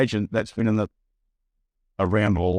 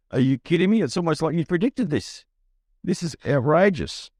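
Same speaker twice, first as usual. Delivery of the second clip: high, incredulous outrage; retelling his shock at the agent, pitched up; mock outrage; 7 semitones higher than usual. Shocked reaction to agent's call.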